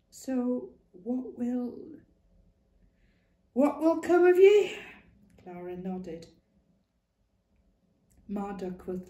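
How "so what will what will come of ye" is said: high, pleading cry rising to a near-shout; fearful plea. Acted. Clara begging Ma what will become of her, afraid. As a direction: beg for an answer in fear.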